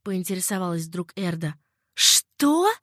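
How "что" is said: high, loud gasp of horror; horrified gasp. A narrator's reading. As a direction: choke out the word in shock. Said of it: "задохнулась от ужаса Риган". (потрясённый вскрик, высоко и громко)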